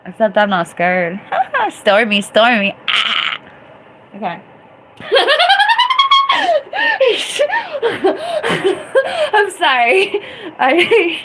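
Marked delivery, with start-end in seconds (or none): 5.0-11.2 high, loud shrieking laugh; can't stop laughing; real helpless laughter; laughing fit. Real, not acted. Bursting laughter.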